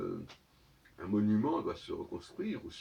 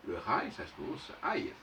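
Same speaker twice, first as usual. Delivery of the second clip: ordinary delivery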